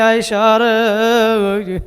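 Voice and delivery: slow, drawn-out chanted call. Acted. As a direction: call her name, lingering on the ending. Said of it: "ডাক দিয়া বলে". (সুর করে টেনে ডাক)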